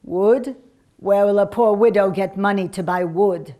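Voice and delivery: a hint of indignant invalid; poor sick widow. A narrator's reading. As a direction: protest as a poor ailing woman.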